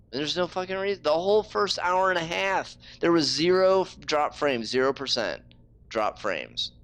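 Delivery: high-pitched, indignant rant; heated frustration. Real, not acted. Streamer protesting that frames were fine earlier, heated frustration. Ranting about sudden dropped frames.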